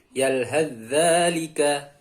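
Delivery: "Yalhadzalika" slowly, one doubled dz sound; sa merged into zal. Shown as a demonstration. Teacher models sa vanishing into zal, slow merged recitation. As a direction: draw out "Yalhadzalika" with no th before the dz. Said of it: "Jadi huruf sa-nya hilang".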